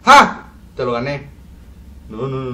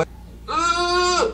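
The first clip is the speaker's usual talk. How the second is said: usual speaking voice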